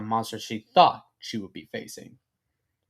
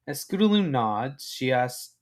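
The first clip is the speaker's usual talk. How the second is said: ordinary delivery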